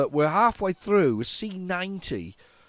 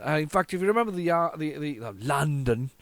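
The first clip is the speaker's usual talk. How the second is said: usual speaking voice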